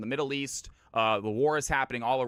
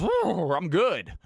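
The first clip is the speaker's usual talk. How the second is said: high, loud horse whinny into words; whinny, then answer as a horse; talking horse; 5 semitones higher, 5 dB louder, much slower than usual. Acted. Horse answering the ventriloquist in a joke, high whinnying voice. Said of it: "Horse goes".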